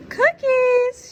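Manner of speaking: high loud sing-song cookies; delighted grandma calling cookies; excited offer. Acted. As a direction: announce it like a treat.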